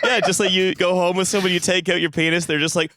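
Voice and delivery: usual speaking voice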